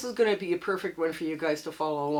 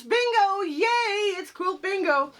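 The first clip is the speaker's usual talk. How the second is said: excited; 12 semitones higher, slower than usual. Real, not acted. Excited show intro cheer.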